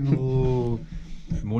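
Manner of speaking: normal talking voice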